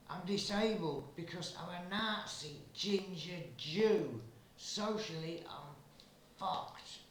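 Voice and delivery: a hint of a German soldier; Fritz's voice. Fritz's excited reply.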